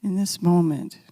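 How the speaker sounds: a calm voice guiding listeners with their eyes closed; guided meditation. Acted. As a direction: lead them gently along the path.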